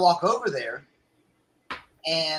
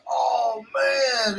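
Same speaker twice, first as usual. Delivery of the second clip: mock dismay; 6 semitones higher than usual. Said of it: "He goes".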